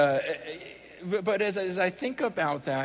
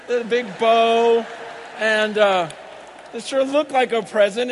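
hammed-up ad voice; 5 semitones higher, 8 dB louder than usual. Mocks TV car commercials.

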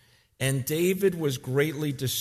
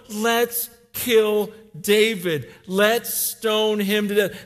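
loud, slow threat; angry mob; 9 semitones higher, 7 dB louder, slower than usual. Retold or imitated. Soldiers plotting to stone David, angry. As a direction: spit out the threat.

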